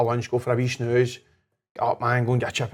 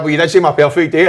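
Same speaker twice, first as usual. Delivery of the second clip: higher, louder laughing defence; playful excuse; 5 semitones higher, 9 dB louder than usual. Retold or imitated. Husband defending himself to his wife, higher and louder, laughing. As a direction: brush it off cheekily. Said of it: "I'm like".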